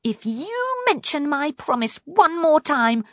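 high-pitched, forceful threat; angry snapping. A narrator's reading. Poppy threatens to throw the frog out, angry snapping. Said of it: "snapped Poppy".

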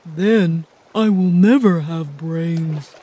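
slow, mildly sad Scarecrow voice. A narrator's reading. Scarecrow despairing on the raft, slow and sad. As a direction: let the hope drain out. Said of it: "said the Scarecrow sadly".

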